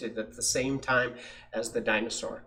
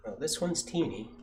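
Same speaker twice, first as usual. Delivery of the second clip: ordinary delivery, nothing marked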